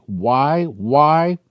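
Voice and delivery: usual speaking voice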